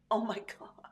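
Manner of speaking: awed whisper. Real, not acted. Host reacting to the gym throw, stunned whisper. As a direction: breathe it out, stunned.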